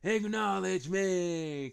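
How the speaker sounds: slow, drawn-out Roman Reigns voice; Roman Reigns impression. Wrestler to the crowd, slow commanding imitation. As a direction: lay it on heavy and slow like Roman. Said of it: "He goes".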